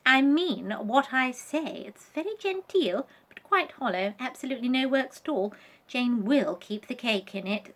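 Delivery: a prim doll who won't be corrected; Vanessa voice. Acted. Vanessa's voice in the story.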